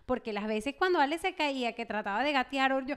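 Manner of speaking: usual speaking voice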